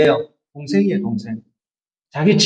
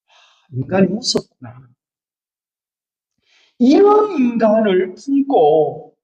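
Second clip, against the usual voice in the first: usual speaking voice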